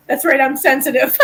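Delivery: laughing through the words. Real, not acted. Joking to friends about being sensitive, laughing. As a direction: giggle while saying it.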